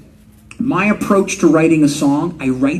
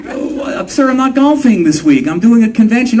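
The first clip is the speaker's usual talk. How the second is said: higher, apologetic mock-polite voice; mock polite reply; 7 semitones higher, 4 dB louder than usual. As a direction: fumble a courteous excuse. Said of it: "I'm like".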